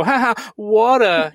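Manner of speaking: high, loud teasing echo of "water"; jeering laugh, then mimic the word; mocking imitation. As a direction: make fun of a Long Island accent. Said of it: "they'll make fun of her".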